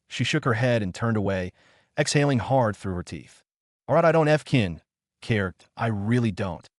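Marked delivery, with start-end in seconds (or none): none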